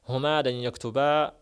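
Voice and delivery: تكتبا said loudly with the final ا clear, no نون; loud model reading of تكتبا; تكتبا spoken out with the نون dropped; stressed example. Shown as a demonstration. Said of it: "أقول".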